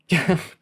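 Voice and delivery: quick amused laugh; a burst of laughter at her; short laugh. A narrator's reading. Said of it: "Kyle said, laughing".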